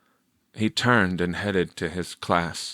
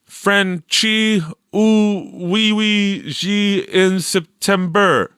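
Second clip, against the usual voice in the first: repeated fake French; 11 semitones higher, 4 dB louder, slower than usual. A narrator's reading. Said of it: "Victor repeated".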